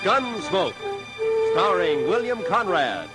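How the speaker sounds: ordinary talk, nothing marked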